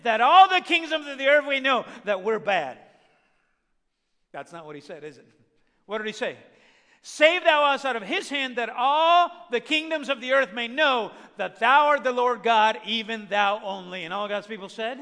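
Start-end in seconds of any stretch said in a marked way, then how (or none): 0.0-2.8 loud, forceful scripture reading; preaching at full force. Acted. Preacher quoting Hezekiah's prayer to church, loud and forceful. 7.0-14.0 loud, forceful scripture reading; preaching at full force. Acted. Preacher quoting Hezekiah's prayer to church, loud and forceful.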